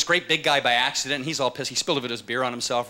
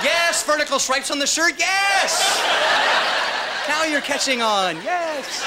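high, loud, drawn-out cheering; mock enthusiasm; 7 semitones higher, 3 dB louder, slower than usual. Comic egging on a skinny guy's rocker look, mock enthusiasm. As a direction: hype it up with big high yeses. Voicing delight at his own awful look.

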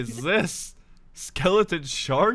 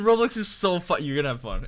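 ordinary talk, nothing marked

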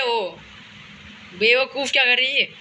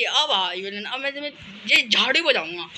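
usual speaking voice

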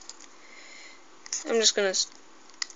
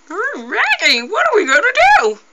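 high toy voice; 11 semitones higher, 4 dB louder than usual. Pretend. Toy figure voice.

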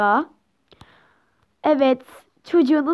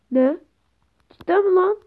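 normal talking voice

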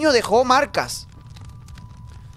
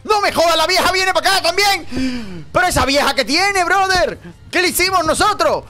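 panicked outcry; 7 semitones higher than usual. Real, not acted. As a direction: shout in disbelief at the pursuer. Old woman chasing him too.

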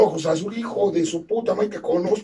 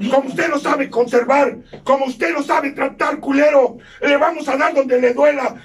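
raised, shouting threats; angry threatening rant; 3 semitones higher than usual. Acted. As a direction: hammer the accusations with rising anger. Amenaza a gritos contra otro. (amenaza furiosa a gritos)

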